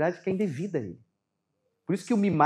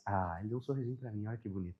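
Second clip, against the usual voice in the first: put-on softer, lower, doting murmur over the baby; tender doting; 8 semitones lower, 12 dB quieter than usual. Father doting softly on his smiling baby at 3 a.m. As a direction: melt at the little smile, hushed and tender. Father melting at baby's smile.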